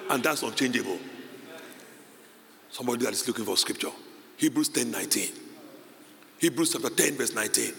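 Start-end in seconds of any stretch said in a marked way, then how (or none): none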